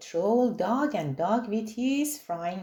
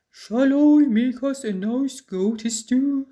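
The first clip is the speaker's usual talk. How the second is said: louder troll voice, hopeful; a troll offering his favourite dish; cheerful troll cook; eager troll; 3 semitones higher, 6 dB louder than usual. A narrator's reading.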